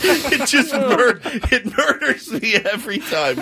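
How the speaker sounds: laughing so hard the words come out high; cracking up. Real, not acted. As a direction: say it helpless with laughter. Cracking up after the bit.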